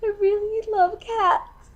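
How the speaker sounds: high-pitched, overwrought near-crying; tearfully emotional. Acted. Dating hopeful who can't hug every cat, tearfully overwrought. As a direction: get worked up to the edge of tears. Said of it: "promised myself I wouldn't cry".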